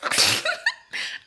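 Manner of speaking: very high airy squeal of a laugh; delighted shriek of laughter; proud giddy laugh. Real, not acted. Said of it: "I did".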